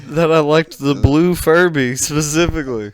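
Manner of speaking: playful imitation of a wowed guest; mock amazement. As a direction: act stunned by the perfect gift.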